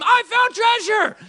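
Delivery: high, slow, drawn-out cry of discovery; shouting the find across the beach; excited yell. Retold or imitated. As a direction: call it out like you struck gold. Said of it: "when you scream".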